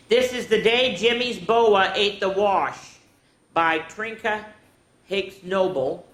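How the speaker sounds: slow, grand title reading; announcing the title. Acted. Teacher announcing the book title to the kids, slow. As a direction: present the book title slowly.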